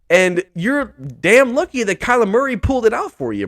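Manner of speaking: ordinary delivery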